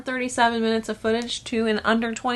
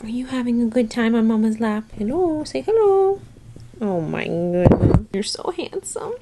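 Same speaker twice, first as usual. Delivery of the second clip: higher, slow doting pet voice; talking to the cat; 4 semitones higher than usual. Real, not acted. Owner cooing at her cat on her lap, slow high baby talk.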